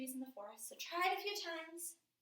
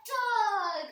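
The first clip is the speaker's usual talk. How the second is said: loud, higher, drawn-out story reveal; dramatic reveal; 6 semitones higher, 10 dB louder than usual. Acted. Teacher telling kids who chases the cat, dramatic reveal. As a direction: burst out with the big surprise.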